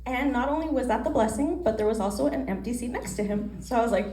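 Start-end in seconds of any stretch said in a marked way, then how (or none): none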